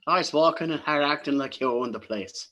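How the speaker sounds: terrible Irish accent, hammed up; a bad stage Irish voice. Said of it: "I'm a terrible Irish accent".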